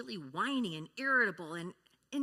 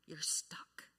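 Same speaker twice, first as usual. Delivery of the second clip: soft unvoiced whisper; a speaker whispering to stress being stuck; 5 semitones lower, 6 dB quieter than usual. Real, not acted. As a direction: drop to a whisper. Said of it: "whispered emphasis".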